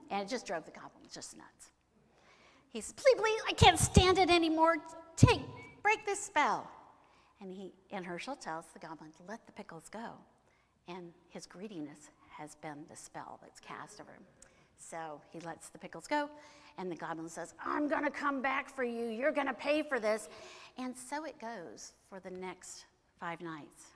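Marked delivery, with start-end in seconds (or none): 2.9-6.7 high, loud, frantic plea; desperate begging. Acted. Trapped goblin begs to break the spell, high and desperate. 17.6-20.3 raised, louder angry threat; vengeful goblin. Acted. Goblin threatens to come back, raised angry voice.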